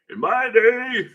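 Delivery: high, loud, hammed-up old-timer; mock old-timer. Quoting what they used to say, mock old-timer. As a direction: push it up high like a crotchety elder.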